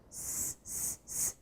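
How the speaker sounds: voiceless hissing S, s, s; s sound. Shown as a demonstration. Teacher showing pupils the S sound, a soft voiceless hiss. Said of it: "isso é som".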